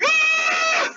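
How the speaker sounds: high, loud frightened animal cry; sharp high screech of fright; animal scream. Acted. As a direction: let out a panicked animal shriek. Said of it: "Nilo is going".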